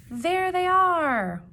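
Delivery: high, slow, loud thrilled announcement; gasping delight at who's arriving; excited reveal. Acted. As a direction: draw it out like a big surprise. Excited reveal reading to kids.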